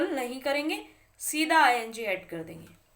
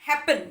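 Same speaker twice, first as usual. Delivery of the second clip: Happen punched loud on है, falling after; teacher's emphatic happen, weight on the opening vowel; stress on first syllable; 3 semitones higher, 7 dB louder than usual. Shown as a demonstration. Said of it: "पहले वाले syllable पे आ रहा है".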